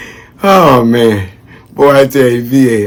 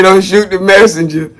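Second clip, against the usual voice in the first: quoting Haley; 7 semitones higher than usual. Retold or imitated. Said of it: "Haley say".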